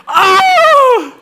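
high, loud drawn-out howl; mock pain scream. Pastor retelling being stuck by his pencil, hammed-up howl.

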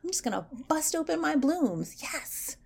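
excited tree. Pretend. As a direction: burst out like a tree that can't wait for spring. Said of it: "they're probably like".

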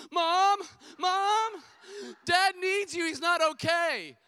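put-on child yelling urgently for mom, high and very loud; son's alarmed shout. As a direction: imitate a panicked son calling for help. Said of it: "I hear him just go".

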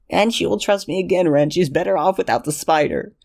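put-on high, pleading young man's voice; desperate Lexington. Lexington defending himself.

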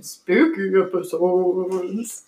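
slow, low spooky voice. Pretend. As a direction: creep it out slowly. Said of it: "You love my character voice".

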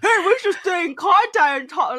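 laughing lightly voiced frat bro. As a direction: play a hearty bro, laughing. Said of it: "You sound like a frat bro".

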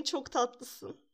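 normal talking voice

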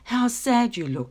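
sad thought. Acted. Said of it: "she thought".

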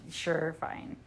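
low, soft, mock indifferent go-ahead; offhand consent. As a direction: give in with a retold shrug, quietly. Senator's indifferent consent, retold.

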